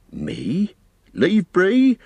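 alarmed, leaping to a high pitch; scared innkeeper. Acted. Innkeeper asked to leave his village, scared refusal. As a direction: balk in fright at the very idea. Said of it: "looking really scared".

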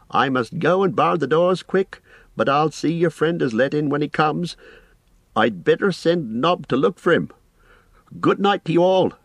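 high-pitched, busy landlord voice; bustling innkeeper. Acted. Innkeeper leaves to bar the doors, bustling. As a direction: hurry through the arrangements.

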